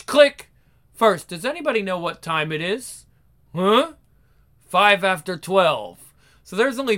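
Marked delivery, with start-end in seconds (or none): 0.0-0.5 higher, louder punchy click. Acted. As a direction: pop the last click out. Imitating camera sounds.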